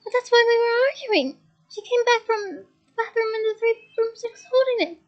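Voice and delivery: distraught. A narrator's reading. Leanne explaining the necklace to Hermione, distraught. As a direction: spill it out in distress.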